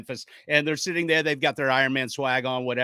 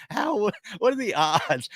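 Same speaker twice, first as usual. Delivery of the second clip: higher and slow, disbelieving; amazed; 7 semitones higher than usual. Retold or imitated. Friends at the table reacting in slow, high amazement. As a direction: stretch it out in wonder. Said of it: "they were like".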